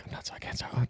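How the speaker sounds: hushed, almost voiceless retold panic; panicky muttering. Looking around for the stingray's barb, hushed panicky mutter. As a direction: mutter it under your breath. Acting out nervous shuffle among stingrays.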